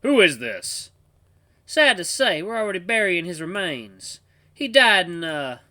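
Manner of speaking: loud put-on southern disguise; southern accent. Grandpa pretending to bury the phone's owner, southern accent. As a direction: lay on a southern accent, improvising. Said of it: "He lowered his voice and used a southern ac".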